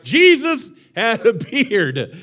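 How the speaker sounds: slow, high, loud yell; battle-cry shout. Retold or imitated. Preacher repeats the all-caps comment as a slow battle cry. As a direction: roar it like a charge. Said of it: "like William Wallace screaming".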